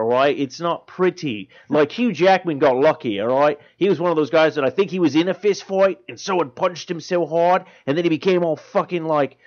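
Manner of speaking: animated broad Aussie rant; grumbling, riled-up Australian comic character; Australian accent. Acted. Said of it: "a long rant from an Australian".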